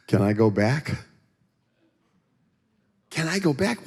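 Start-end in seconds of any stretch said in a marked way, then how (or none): 3.1-3.9 high-pitched mock repeat of the question; comic disbelief, pitched way up; incredulous self-mockery. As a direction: squeak it in disbelief at yourself. Mocking his own question in disbelief.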